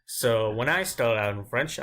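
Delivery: ordinary talk, nothing marked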